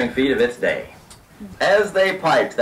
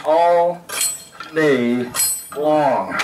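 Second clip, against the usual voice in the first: drawn-out monotony; much slower than usual. Shown as a demonstration. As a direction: separate each word with a pause. Shows monotony of pounding posts.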